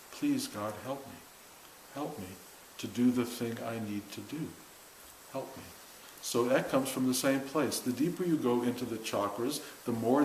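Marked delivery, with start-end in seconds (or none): 0.1-5.6 much softer, pleading prayer; quiet prayer. Retold or imitated. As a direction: pray it softly, almost to yourself. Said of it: "it becomes prayer".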